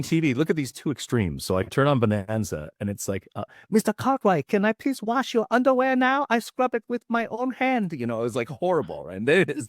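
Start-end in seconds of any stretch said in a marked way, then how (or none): 3.7-7.9 put-on high-pitched caricature of a Chinese accent; exaggerated Chinese accent. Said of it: "exaggerated Chinese accent".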